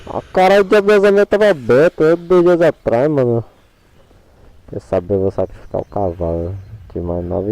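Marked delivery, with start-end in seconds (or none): 0.1-3.4 loud, annoyed gamer rant; frustrated outburst. Real, not acted. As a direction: blurt out the frustration, louder. Gamer annoyed at missed wedding. (desabafo irritado e alto)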